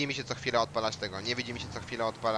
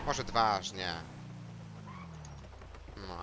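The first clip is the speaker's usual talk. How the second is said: ordinary talk, nothing marked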